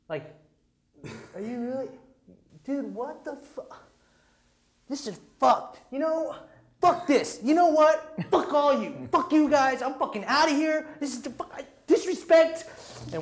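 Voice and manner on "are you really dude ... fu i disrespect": high-pitched outraged rant, building louder; mock fury in a raised, squeaky-high voice; imitated freak-out. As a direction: mimic a friend flipping out and storming off. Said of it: "So he freaks out".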